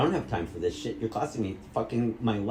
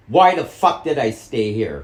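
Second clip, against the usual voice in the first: louder furious demand; angry shout; 9 dB louder than usual. Real, not acted. Son shouting at his mother. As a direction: explode at her.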